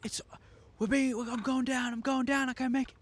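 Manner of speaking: high, strained cry of a pilot going down; a mock panicked pilot crashing. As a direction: play the doomed pilot, voice up high.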